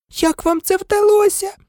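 very high squeaky question; squealing. A narrator's reading. Angelina Johnson asking the twins, squealing. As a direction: squeal it in amazement. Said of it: "запищала Анжеліна Джонсон".

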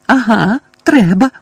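high, loud screech; angry neighbour screeching agreement; shrieking. A narrator's reading. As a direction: screech it while hitting him. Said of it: "верещала місіс Фіг".

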